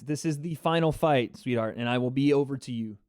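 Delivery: ordinary delivery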